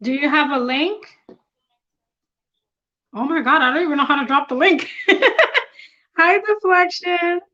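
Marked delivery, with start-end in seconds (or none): 4.9-5.6 a quick high giggle at herself; high burst of laughter. Real, not acted. Flustered streamer laughing at her own tech trouble, high giggle. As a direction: laugh out loud at not knowing how. Laughing while fumbling the link.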